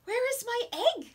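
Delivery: bunny voice. Acted. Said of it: "said the bunny".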